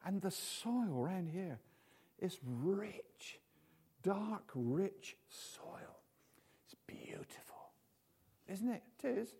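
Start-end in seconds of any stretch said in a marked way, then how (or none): 5.3-7.7 slow whisper of delight; soft admiring whisper. Real, not acted. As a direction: whisper it slowly, savouring it. Savouring the soil aside.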